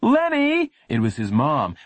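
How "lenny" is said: loud call across the house. Acted. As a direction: shout his name from afar. Mom calling from porch.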